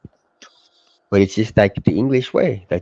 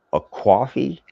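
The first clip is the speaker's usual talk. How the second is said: mock East Coast accent; 7 semitones higher, slower than usual. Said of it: "piss take of a East Coast accent".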